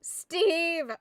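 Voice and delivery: long, loud, desperate call across the distance; yelling like Wilson. Pretend. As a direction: call for Steve like a lost friend on the beach. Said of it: "I'm trying to do it like Wilson".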